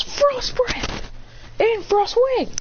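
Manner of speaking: high-pitched battle command; attack call. Retold or imitated. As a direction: call the special moves out high. Figure calls out attacks.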